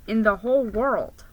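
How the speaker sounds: ordinary delivery, nothing marked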